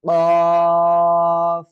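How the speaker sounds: কফ held long and loud from the back of the throat; teacher models qaf for the class; Arabic qaf demo. Shown as a demonstration. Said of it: "তাহলে সেটা কি হবে?".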